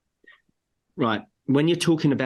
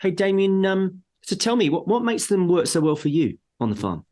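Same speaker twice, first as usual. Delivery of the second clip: higher, upbeat curious question to the client; friendly salesman; 7 semitones higher than usual. Retold or imitated. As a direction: sound interested and friendly, pitched up. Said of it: "And then we say".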